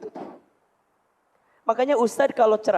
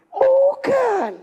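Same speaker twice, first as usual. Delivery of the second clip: emphatic denial; 13 semitones higher than usual. Real, not acted. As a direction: reject it sharply. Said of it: "Bukan manja".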